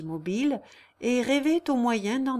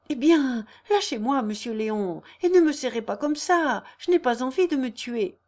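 raised, louder indignant protest; acted flustered objection, pitched up; protesting young woman; 5 semitones higher, 6 dB louder than usual. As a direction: push him off with your voice. Jeanne protests being gripped.

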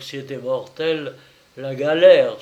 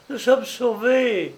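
high, slow exclamation; relieved cry; 9 semitones higher, 4 dB louder than usual. A narrator's reading. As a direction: draw it out high, overcome with relief. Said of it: "s'écria Marie en se relevant".

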